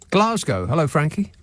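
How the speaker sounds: usual speaking voice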